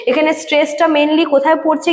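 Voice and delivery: ordinary talk, nothing marked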